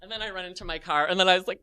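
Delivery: usual speaking voice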